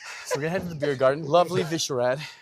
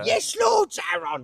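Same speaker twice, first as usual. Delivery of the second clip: orc voice; 6 dB louder, slower than usual. Acted. Playing an orc servant.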